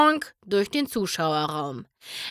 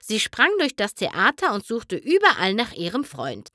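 usual speaking voice

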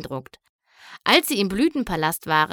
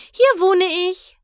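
proud excitement; 11 semitones higher, 6 dB louder than usual. Acted. Princess proudly shows her home.

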